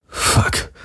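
breathless whisper; breathed. Acted. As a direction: gasp it out on the breath. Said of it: "hauchte er".

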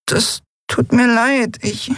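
slow, high, tearful tiny voice; remorseful. A narrator's reading. Repentant Nelen apologises to Endriel, high, slow and tearful. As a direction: apologise haltingly and fade out. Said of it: "ließ reuig die Flügel hängen".

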